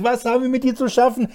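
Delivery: high, loud begging cry, slowing at the end; demons crying out. Retold or imitated. Preacher voicing demons screaming at Jesus' feet, loud high pleading. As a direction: plead for mercy like a cornered demon. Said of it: "schrien sie".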